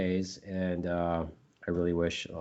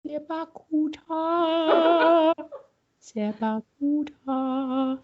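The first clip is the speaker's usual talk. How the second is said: high-pitched chant, stretching out longer each time; silly drawn-out word repeated over and over; mock obsessive repetition; much slower than usual. Host imagining him driving around saying it, silly repeated chanting. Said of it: "driving around and going".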